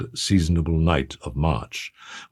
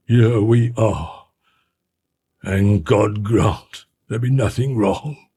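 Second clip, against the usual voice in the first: slow, strained, slightly raised voice; harsh, broken voice; 4 semitones higher, slower than usual. A narrator's reading. Anguished butler to the lawyer at the door, harsh and broken. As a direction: drag the words out, as if choked by anguish. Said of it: "his voice when he spoke harsh and broken".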